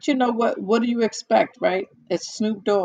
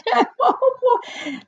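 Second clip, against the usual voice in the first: high amused laugh; 3 dB louder than usual. Real, not acted. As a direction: burst out laughing. Laugh after point.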